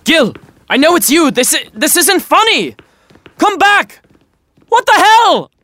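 loud rising calls; calling out. Acted. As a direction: shout after him. Calling after the vanished ghost.

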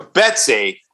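slow, drawn-out jingle announcement; announcer intro. Shown as a demonstration. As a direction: stretch it like a news intro. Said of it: "you're supposed to do that with me".

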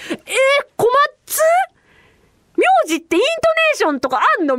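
high-pitched, astonished questioning; shocked disbelief. Retold or imitated. Newcomer learning names have intonation, high-pitched surprise. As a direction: act out a startled discovery. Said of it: "みたいな感じで".

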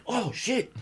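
high, laughing oh shit; a jumpy half-laughing curse; startled. Retold or imitated. Startled by the fan's yelp.

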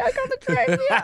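high, giggling punchline; laughing so hard it squeaks out; laughing through it. Real, not acted. Retelling while laughing.

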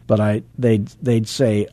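normal talking voice

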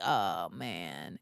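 very low, hushed groan of dismay; retold dismay. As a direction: let it sink low and quiet, in mock despair. Dismayed reaction to the imitation.

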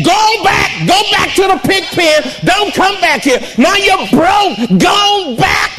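high, shouted mock scolding; angry father's rejection. Father of the prodigal turning his son away, shouting. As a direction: drive the son back to the pig pen. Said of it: "he didn't say".

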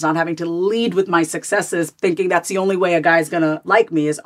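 usual speaking voice

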